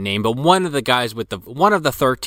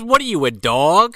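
mocking taunt; 6 semitones higher, 4 dB louder than usual. Retold taunt, high and loud.

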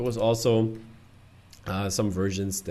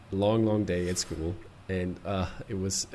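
tired, worn-out voice almost gone; hoarse, strained voice after a long day; voice giving out; 4 semitones lower, 3 dB quieter than usual. Real, not acted. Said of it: "you can hear my voice is almost gone".

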